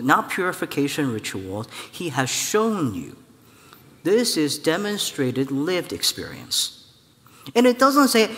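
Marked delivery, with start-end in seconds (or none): none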